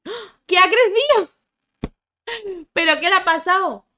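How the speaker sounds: high, loud startled cry; shocked shriek. Real, not acted. Gamer sees her Sim suddenly grown, shocked and loud. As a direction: gasp it out in disbelief.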